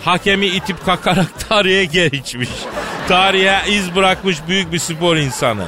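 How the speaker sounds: grand announcer intro. Acted. Host presenting the chairman to the studio, grand announcer build-up. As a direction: hype the guest like a ring announcer, swelling as you go.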